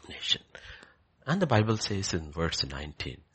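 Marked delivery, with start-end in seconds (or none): 0.1-0.4 soft breathy repeat of Nation; whispered echo. Real, not acted. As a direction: let the word fall to a whisper. Echoes word in whisper.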